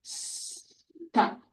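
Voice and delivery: whispered "Stop" with quiet P. Shown as a demonstration. Therapist whispering the model word. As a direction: breathe "Stop" softly. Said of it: "Say top".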